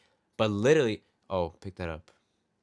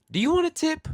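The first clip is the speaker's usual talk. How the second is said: mock flirty, much higher squeal; flirty woman; 6 dB louder than usual. Server imitating a flirty customer offering a tip, high. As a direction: squeak it up coyly. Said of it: "she's like".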